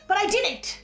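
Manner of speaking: usual speaking voice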